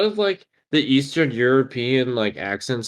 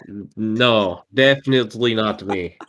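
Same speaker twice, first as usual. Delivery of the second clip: ordinary delivery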